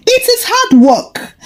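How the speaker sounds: proud reply pitched high. Acted. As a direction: answer proudly, voice lifted. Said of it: "Ikpendu replied proudly".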